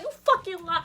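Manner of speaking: high, loud angry accusation; spazzing. Retold or imitated. Girlfriend confronting her lying man, loud angry outburst. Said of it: "I literally started ba".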